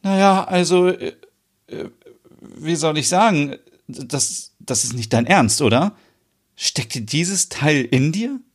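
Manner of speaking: lightly voiced, embarrassed hesitation; a hint of squeamish disbelief; flustered Merit. A narrator's reading. Merit fumbling for words.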